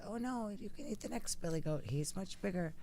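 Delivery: lightly voiced storybook goat talking his way out; medium billy goat. Middle goat offers up his bigger brother, soft acted voice. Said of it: "the medium one says".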